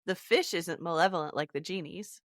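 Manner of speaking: ordinary talk, nothing marked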